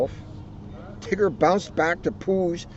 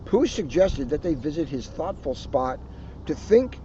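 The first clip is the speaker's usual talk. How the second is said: usual speaking voice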